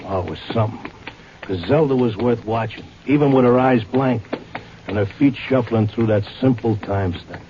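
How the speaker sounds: low, even noir narrator voice; story narration. A narrator's reading. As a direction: tell it looking back, low and steady. Narrates over music.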